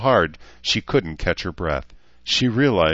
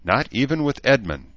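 higher, falling end on Edmund; admitting; 7 semitones higher, 4 dB louder than usual. A narrator's reading. Human woman confirming it to the elf, admitting. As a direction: confess it as a settled statement. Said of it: "Danae admitted".

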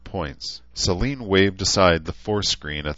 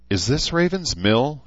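high disbelieving question; incredulous; 8 semitones higher than usual. A narrator's reading. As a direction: ask it in disbelief. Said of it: "she asked incredulously".

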